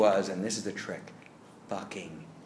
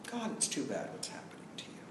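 soft, breathy mock pity as the character; hushed sympathy; 5 semitones higher, 12 dB quieter than usual. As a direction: murmur the false sympathy under your breath. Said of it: "the character is just sort of, you know".

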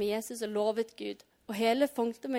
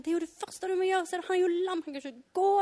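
higher-pitched, urgent objection; incredulous protest; 7 semitones higher, faster than usual. Pretend. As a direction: protest as if it were obvious. Voices own objection to Jesus.

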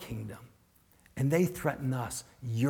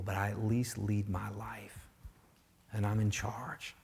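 softer, near-whisper self-assurance; whispered inner claim; 4 semitones lower, 8 dB quieter than usual. Retold or imitated. Preacher voices the heart's hushed claim to control. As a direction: murmur it like a private vow.

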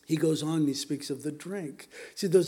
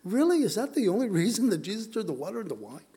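incredulous; 4 semitones higher, faster than usual. Acted. Preacher challenging a view.

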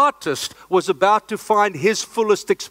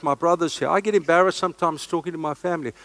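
ordinary talk

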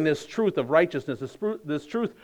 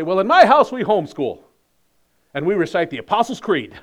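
louder, higher show-off boast; mock smug bragging; 3 semitones higher, 6 dB louder than usual. Preacher mocks churchgoer bragging to parent, smug and loud. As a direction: brag with a self-satisfied lift.

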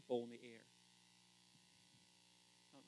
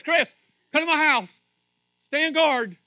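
loud, raised urgent call; calling for help; 9 semitones higher, 16 dB louder, slower than usual. Acted. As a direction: shout down the phone for backup. Acts out calling his brother for help.

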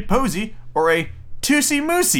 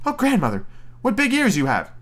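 put-on little girl voice; 8 semitones higher than usual. Girl addresses the disguised wolf.

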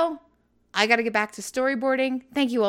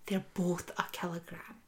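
hushed explaining; 4 semitones lower, 10 dB quieter than usual. Retold or imitated. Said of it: "He says".

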